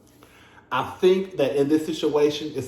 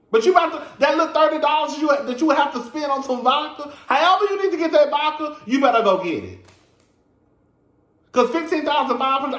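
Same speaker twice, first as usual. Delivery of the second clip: higher, pushing hard through the rant; heated rant; 9 semitones higher than usual. Real, not acted. Ranting at the bartender to fetch the vodka. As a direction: scold her like she should know better.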